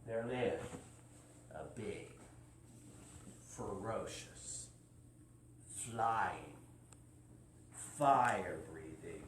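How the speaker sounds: low, slow, hushed suspense; spooky build-up. Acted. Building up a scary dragon.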